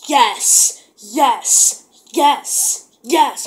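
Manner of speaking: loud, punched-out cheers; emphatic, jubilant outbursts; retold elation. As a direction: celebrate each word separately. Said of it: "I was like".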